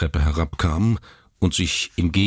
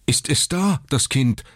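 high, urgent pressing question; anxious father pressing the doctor; 10 semitones higher than usual. Acted. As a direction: push him for an answer. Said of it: "drängte Mister Button".

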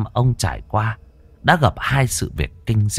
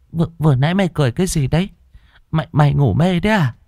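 shaken mother; 8 semitones higher, faster than usual. Acted. Mother scolding scared son.

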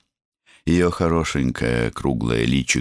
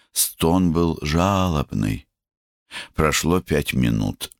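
ordinary delivery

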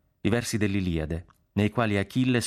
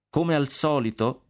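higher, slow pulpit opening; preaching; 7 semitones higher, slower than usual. A narrator's reading. Young curate opening his sermon to parishioners, preaching. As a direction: open a sermon, slow and raised in pitch. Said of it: "disse il giorno dopo nella sua predica".

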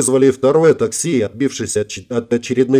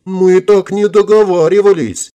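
high, loud, slow whiny protest; hammed-up frightened objection; feigned horror; 7 semitones higher, 5 dB louder than usual. A narrator's reading. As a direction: wail it in pretend horror. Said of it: "Чуть не задрожала от притворного ужаса".

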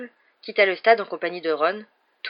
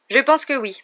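light, higher-pitched offhand reply; casual; 7 semitones higher, 6 dB louder than usual. A narrator's reading. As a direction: toss it off as if unconcerned. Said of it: "d'un ton dégagé".

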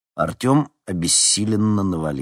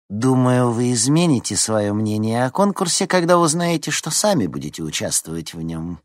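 high start sliding down, lightly snide; 6 semitones higher, 3 dB louder than usual. A narrator's reading. Head teacher springing the contest on Nikita, snide. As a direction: needle them with a knowing jab. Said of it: "ехидно произнесла зауч".

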